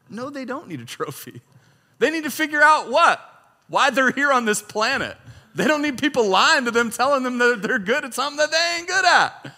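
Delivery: loud, high preaching cry; preaching at full force. Real, not acted. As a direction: drive the point home to the congregation.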